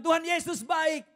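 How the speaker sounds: high, loud praise; joyful praise. Retold or imitated. Believer praising Jesus to his parrot, high and loud. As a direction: proclaim it joyfully. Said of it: "Dia bilang".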